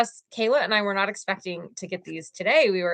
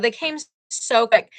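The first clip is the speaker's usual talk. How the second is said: ordinary talk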